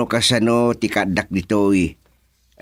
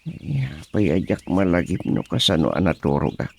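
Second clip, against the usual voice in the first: ordinary talk, nothing marked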